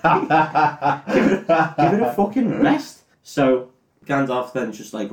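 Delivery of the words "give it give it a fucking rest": higher, fast, fed-up mock rant; hammed-up irritation, pitched up; exasperated Frodo. Frodo snaps back at Gandalf.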